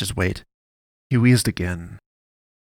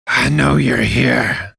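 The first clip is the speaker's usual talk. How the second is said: louder, slow and self-assured fat priest; wheezy old priest calling out into the dark; confident man's voice; 3 semitones higher, 8 dB louder, much slower than usual. A narrator's reading. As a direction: let it cut through the silence, sure of himself. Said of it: "cut through the silence with disconcerting confidence".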